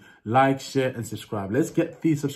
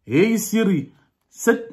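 higher, louder Zimbabwean accent; raised Zimbabwean-accented voice command; 7 semitones higher, 5 dB louder, slower than usual. Shown as a demonstration. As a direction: call the assistant in a Zimbabwean accent. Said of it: "I'm gonna do a Zimbabwean accent".

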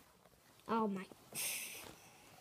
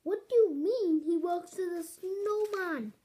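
louder, higher demanding question; urgent raised demand; insistent; 4 semitones higher, 4 dB louder than usual. Acted. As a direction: press her hard for an answer. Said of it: "I insisted".

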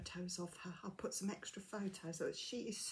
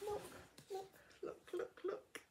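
excited look look; 12 semitones higher, 5 dB quieter than usual. Real, not acted. Excited at stitch marker.

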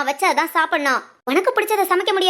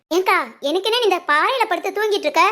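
normal talking voice

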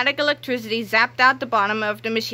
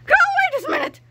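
high scolding command; stern shout; 3 dB louder, faster than usual. Acted. Miss Ribble scolding the toilets, high stern shout. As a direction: order it like a strict teacher. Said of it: "she cried".

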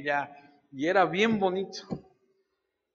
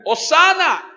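high, loud acclaim; crowd shouting hosana; 11 semitones higher, 9 dB louder, slower than usual. Retold or imitated. Crowd hailing Jesus on Monday, high loud shout. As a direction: cry it out as the crowd. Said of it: "que el lunes gritan".

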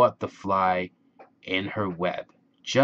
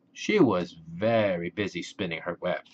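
ordinary delivery, nothing marked

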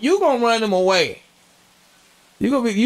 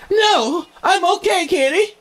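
high, eerie mock robot reply; robot woman answering, high and eerie; creepy robot voice; 12 semitones higher than usual. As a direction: play the possessed robot sweetly. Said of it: "robot answering in movie".

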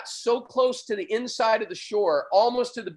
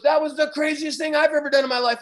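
higher, keyed-up imitation of the driver; driver's echo; 6 semitones higher, 4 dB louder than usual. Driver telling the surfer the same thing back, mildly hyped. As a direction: echo it back, lightly amazed. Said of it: "he looks at me and he goes".

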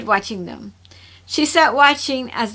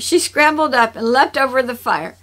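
ordinary delivery, nothing marked

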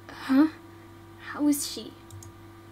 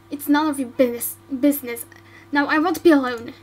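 fast, louder brushing off; snappy irritation; 4 dB louder, faster than usual. Acted. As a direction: shut the healer out. Snaps at healer.

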